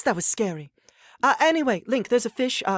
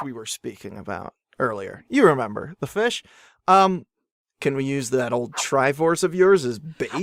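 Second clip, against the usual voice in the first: low, sly scheming voice; deep hero voice; 11 semitones lower than usual. Acted.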